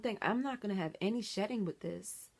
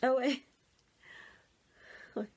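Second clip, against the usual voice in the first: ordinary talk, nothing marked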